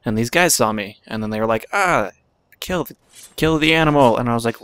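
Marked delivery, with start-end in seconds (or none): 1.7-4.2 raised, higher-pitched imitation of attackers yelling; mock attack cry. Attackers spotting him by the thing, mock yell. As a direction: mimic a gang sounding the alarm.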